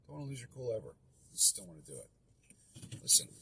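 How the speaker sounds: usual speaking voice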